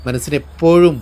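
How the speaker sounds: ordinary delivery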